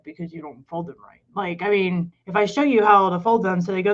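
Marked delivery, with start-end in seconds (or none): 0.0-1.2 low, very soft aside; muted retort. Retold or imitated. Wife retelling her reply to her husband, low muted retort. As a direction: answer back under your breath.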